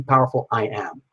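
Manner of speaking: ordinary talk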